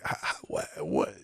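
whispered, stammered what; stunned breathless what. Retold or imitated. Newcomer caught off guard, stammering whisper. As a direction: gasp it out, barely voiced. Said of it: "they came down to me going".

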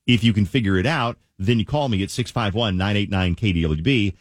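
upbeat DJ promo read; radio contest promo. Acted. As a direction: sell the contest, big radio voice.

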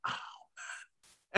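almost whispered reaction; hushed awe. Retold or imitated. Viewer reacting to a well-done scene, hushed. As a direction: let it out under the breath, very soft. Retells own awed reaction.

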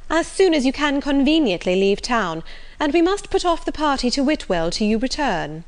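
high, polite ladyship's voice; Lady Middleton, courteous. Acted. Her ladyship inviting the Colonel back after town, courteous. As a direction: lift the pitch into gracious social insistence.

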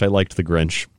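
ordinary talk

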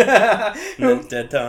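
laughing. Real, not acted. Laughing list of Tom names.

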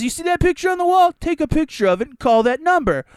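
hammed-up snooty clerk; mock clerk voice. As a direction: imitate a dismissive counter clerk. Mocking store clerk.

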